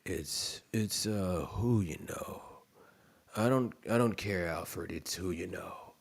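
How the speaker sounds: low, soft, far-off gravel of Batman. Retold or imitated. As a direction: brood it low like Batman to Alfred. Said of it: "in my Robert Pattinson Batman voice".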